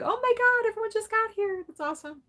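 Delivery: mock gush like a thrilled fan; high-pitched mock excitement; excited chat voice. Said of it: "They're like".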